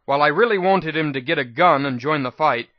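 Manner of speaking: usual speaking voice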